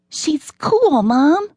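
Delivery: put-on a kid begging Mom to say yes, high; pleading kid. A narrator's reading. Son urges his mom to go, high child plea.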